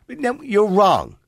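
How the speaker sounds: ordinary delivery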